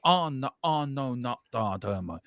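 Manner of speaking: lightly theatrical lament, slowing and fading; a hint of stage tragedy on a drawn-out "Ah"; mild mock-Shakespearean reading. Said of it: "Sounds something Shakespearean".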